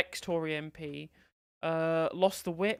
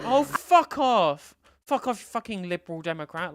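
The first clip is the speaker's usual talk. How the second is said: swearing tirade; 2 semitones higher, 9 dB louder than usual. Real, not acted. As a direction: angrily tell them to fuck off. Streamer swearing at MP on screen.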